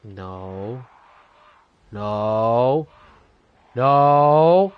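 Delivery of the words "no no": rising panicked yells of no; shouting. Real, not acted. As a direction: build from firm no to scream. Panicked refusal facing giant.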